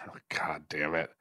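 whispered exasperated curse; whispered groan. Real, not acted. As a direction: breathe it out in despair. Groaning at nerd bait.

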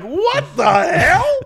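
high, slow what the hell; stunned, drag it out high; incredulous mock outrage; shocked disbelief. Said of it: "And I was like".

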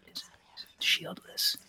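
unvoiced whisper of the word. Shown as a demonstration. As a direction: hiss it out softly like gossip. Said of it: "I was gonna say the shieldless".

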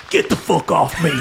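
put-on disgruntled bullywug voice; angry Emmett. Beaten Emmett gets up after wrestling, angry. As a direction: push him away in a character voice. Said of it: "Emmett gets up and goes".

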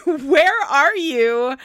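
ordinary delivery

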